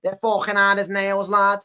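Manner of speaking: exaggerated Liverpool accent; mock Scouse accent. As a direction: put on Scouse, pretending to be scared. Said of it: "you wouldn't do a Scouse accent".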